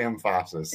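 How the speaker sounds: emphasis said as em-FASS-is; wrong emphasis. Shown as a demonstration. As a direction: jokingly stress the middle of emphasis. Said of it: "emphasis on the wrong syllable".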